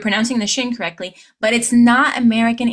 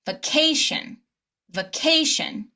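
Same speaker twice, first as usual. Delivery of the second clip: "vacation" with CA raised and lengthened; primary stress; 5 semitones higher than usual. Shown as a demonstration. Teacher modelling for repetition, stress before the suffix. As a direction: lift the middle syllable of "vacation". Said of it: "stress the syllable right before the shin".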